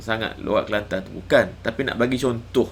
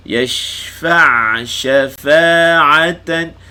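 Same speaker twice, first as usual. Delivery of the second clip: higher, louder Quranic chant, shin drawn out; Quran recitation; 5 semitones higher, 9 dB louder, much slower than usual. Shown as a demonstration. Teacher reciting a verse for students to follow, Quran chant. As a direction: recite yashfa' stretching the shin. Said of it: "Fa tu dua harakat".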